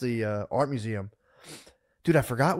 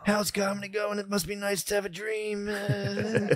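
higher, softer fake-modest guy; mock humble worker; 7 semitones higher, 4 dB quieter than usual. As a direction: play the humble working man.

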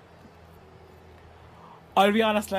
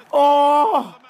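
pained cry; 5 semitones higher, 8 dB louder than usual. Real, not acted. Near miss in penalty drama.

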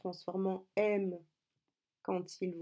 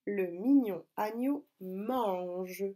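words stretched out slowly; slow dictation; slower than usual. Shown as a demonstration. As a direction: draw out each word for pupils. Rereading dictation for the correction. (dictée lente, mots étirés)

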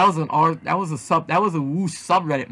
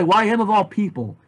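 ordinary delivery

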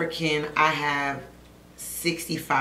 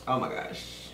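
whispered gasp; 5 semitones lower, 9 dB quieter than usual. Real, not acted.

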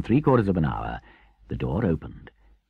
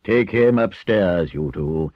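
deliberate, commanding elder; firm, stern Badger; 3 semitones higher, 5 dB louder than usual. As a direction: lay down the law with authority. Badger takes charge and gives orders.

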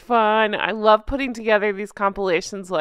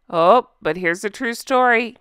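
normal talking voice

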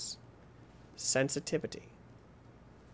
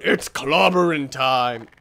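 slow, drawn-out war cry; stretched-out catchphrase; mock battle catchphrase; 5 semitones lower, 4 dB louder, slower than usual. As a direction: announce the attack with hammy weight.

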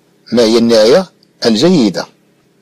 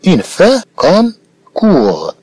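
drawn out slowly; 7 semitones higher, much slower than usual. Shown as a demonstration. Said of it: "cette phrase peut avoir une fin comme".

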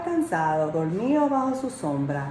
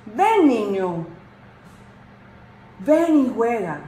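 a touch louder, delighted tree; happy talking tree; 3 semitones higher, 5 dB louder, slower than usual. A narrator's reading. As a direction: call out gladly as the tree. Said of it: "el árbol estaba tan feliz que apenas pudo hablar". (árbol que habla, feliz)

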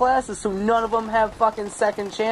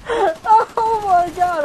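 very high, loud, stretched-out squeal; ecstatic screaming; 11 semitones higher, 6 dB louder, much slower than usual. Real, not acted. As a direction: scream it out in delight. Screaming over a care package.

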